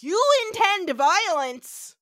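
loud, high, slow hammed-up accusation; loud, high mock accusation, drawn out; mock accusing shout. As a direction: cry it out like a scandalised elder. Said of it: "They're like".

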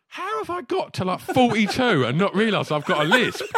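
high, loud comic disbelief; incredulous. Retold or imitated. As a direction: exclaim in mock outrage, nearly laughing. Retells his shock at the lisp.

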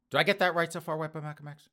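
ordinary delivery

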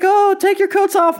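high-pitched fussing grandma; hopeful grandma. Acted. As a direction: put on a high grandma voice welcoming family in. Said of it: "She's like".

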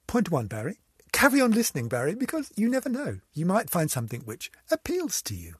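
ordinary talk, nothing marked

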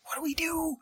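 tiny, high, very soft voice; hushed alarm. Acted. Player hearing groaning from the hole, hushed high alarm. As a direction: squeak it out under your breath.

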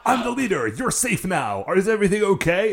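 high-pitched, eager hero act; mock heroic rescuer. Red steals the kill from his team, mock heroic. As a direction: play an overzealous leader checking on everyone.

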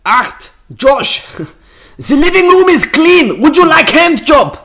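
high, loud, slow mock German woman; shrieky German accent sliding towards Eastern European; female German accent. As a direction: shout it as a hammed-up German robot maid. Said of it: "female German".

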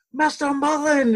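high, drawn-out starstruck call; excited calling out. Retold or imitated. Fan runs into Mister Mullen, excited high call. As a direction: cry the name out high, stretching it.

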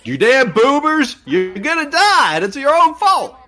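put-on wag a finger and yell at the old folks; mock angry yell. Commenting on a horror movie, yells at the boomer victims in mock anger.

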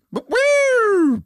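high excited whoop; woo. Retold or imitated. Musician recalls getting pulled over, high whoop. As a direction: whoop like the sirens just hit. Police lights story exclamation.

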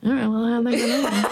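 fast garbled mumble round a mouthful; mouth full. Retold or imitated. Pony accepting a granola bar, muffled mouth-full mumble. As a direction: imitate talking through a bit and snack.

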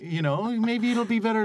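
high, sing-song talking-down tone; sweetly condescending, like soothing a failure; patronizing. Retold or imitated. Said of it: "Being patronized every day".

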